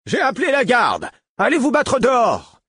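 high, loud bellow to the brawlers; bellowing tavern keeper. A narrator's reading. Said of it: "le tavernier beugla". (hurlement de tavernier furieux)

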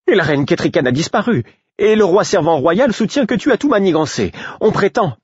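aiguë et pressée, en vrac; précipitée. A narrator's reading. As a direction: tout déballer d'un coup. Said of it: "Dans sa hâte de tout me raconter". (Patience pressée de tout raconter)

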